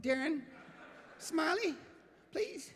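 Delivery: rising, pleading coax. Real, not acted. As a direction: sweet-talk him into it. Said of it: "just do a little bit for me".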